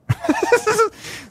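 high-pitched giggle; laugh. Real, not acted. Laughs at the drugged animal.

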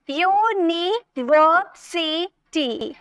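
University slowly in five beats, yu, ni, ver, si, ti; syllable by syllable. Shown as a demonstration. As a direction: break university into five drawn-out pieces. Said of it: "five syllable हो गए".

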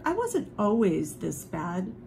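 plain reading voice

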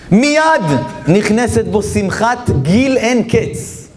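high, slow proclamation of a sacred saying; preaching at full force. Real, not acted. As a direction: declaim the quote slowly and high, weighing every phrase. Proclaims quotation from the sage. (הכרזה חגיגית, איטית וגבוהה)

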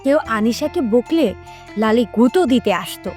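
plain reading voice

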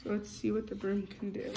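ordinary delivery

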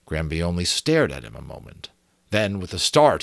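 usual speaking voice

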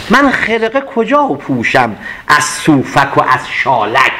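slow, drawn-out verse line; poem recitation. Acted. Teacher repeating the verse for students, slow and deliberate. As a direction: savour the line as you recite.

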